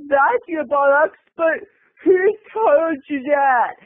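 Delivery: slow, high mock sobbing; Marsha Brady. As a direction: wail it as if hit on the nose. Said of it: "like Marsha Brady when the football".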